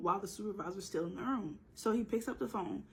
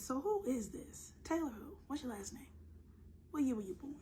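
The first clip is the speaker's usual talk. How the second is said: high interrogating voice; 5 semitones higher, 4 dB quieter than usual. Pretend. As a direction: quiz him like a suspicious agent. Mock interrogating caller.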